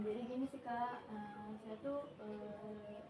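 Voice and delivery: ordinary delivery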